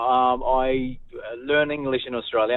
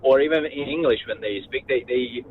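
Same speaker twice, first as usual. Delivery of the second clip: normal talking voice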